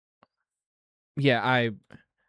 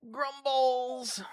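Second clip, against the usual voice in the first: excited call; 10 semitones higher than usual. Real, not acted. Announcing the treat to dog.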